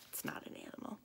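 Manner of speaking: hushed, voiceless correction; whispered aside. Acted. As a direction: drop to a whisper to correct the guess.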